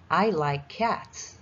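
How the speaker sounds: very slow, each word clear; slow teaching read. Shown as a demonstration. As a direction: spell it out slowly for kids. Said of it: "这里cats一样要加s".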